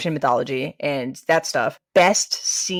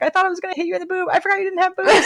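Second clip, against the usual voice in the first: much higher, fast and laughing; laughing confession; faster than usual. Retold or imitated. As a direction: blurt it out through laughter. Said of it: "She's like".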